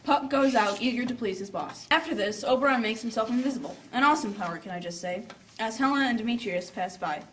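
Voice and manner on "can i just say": normal talking voice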